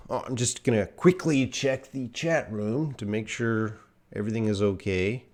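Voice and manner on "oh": ordinary talk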